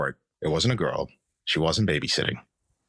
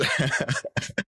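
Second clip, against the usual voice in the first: breathy laugh; 7 semitones higher than usual. Real, not acted. Laughs about being final client.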